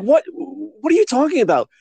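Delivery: high-pitched mock bewilderment; baffled. Anchor not following the guest, baffled. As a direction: imitate a totally lost anchor.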